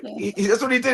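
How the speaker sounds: ordinary talk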